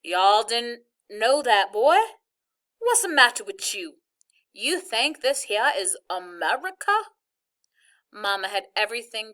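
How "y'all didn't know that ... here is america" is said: loud, drawling Southern hillbilly twang; Southern hillbilly accent, played for laughs; mock hillbilly accent. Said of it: "say some more Southern-style stuff".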